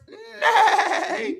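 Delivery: a high, laughing whinny; whinny like a pony, giggling; horse neigh. Acted. Teacher to kids, high laughing horse neigh. Animal sounds in picture book.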